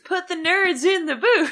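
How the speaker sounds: goofy put-on voice. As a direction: repeat it in a silly voice. Repeats slogan in new voice.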